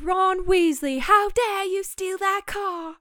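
high, drawn-out theatrical scolding; hammed-up high reprimand; scolding mother. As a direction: act out an outraged mother's telling-off. Acts out film's scolding mother.

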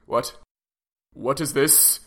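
ordinary talk

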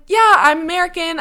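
high, loud imitated reply; emphatic confirmation. Lawyer confirming her nationality to a surprised caller, emphatic. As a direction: pitch it way up, breezily insistent.

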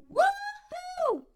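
very high, thrilled woo-hoo; joyful whoop. Real, not acted. Joyful shout after song lyric.

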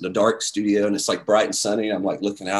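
ordinary talk, nothing marked

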